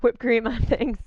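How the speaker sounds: giggling through the words; laughing. Real, not acted. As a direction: laugh while you say it. Joking gratitude amid laughter.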